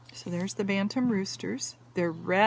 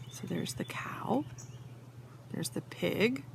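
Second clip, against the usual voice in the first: whisper; 9 dB quieter than usual. Real, not acted. Points out cow to child.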